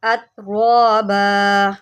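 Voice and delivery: अतराबा with a thick full ra, slow; heavy ra. Shown as a demonstration. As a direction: fatten the ra in अतराबा. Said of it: "रा को मोटा करें".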